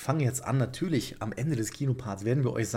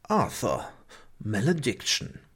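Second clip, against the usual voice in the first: trailer voice. Pretend. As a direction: announce the title like a trailer. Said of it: "Muss ja die Trailerstimme machen".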